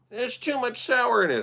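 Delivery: high, whiny mock of the complaint; mimicking a friend who sends his drink back; mocking the buddy. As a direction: parrot the picky friend in a silly squeak. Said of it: "'Cause he did say light on the sour".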